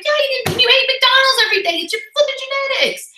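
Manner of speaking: very high, squeaky sassy comeback; mock sassy retort. Talking back to bragging skinny girls, very high and sassy. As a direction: fire back in a shrill teasing voice. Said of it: "I'm like".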